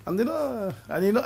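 ordinary delivery

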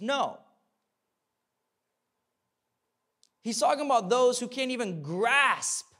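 loud, high pulpit preaching; sermon at full volume; full-force preaching. Acted. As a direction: drive it home to the congregation.

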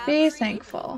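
ordinary talk

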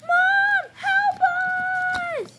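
scared doll calling her mom for help. Acted. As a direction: call out desperately. Kids call mother for help.

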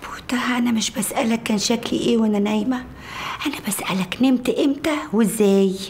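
hushed whisper. Acted. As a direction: keep it under your breath. Wife whispering to husband.

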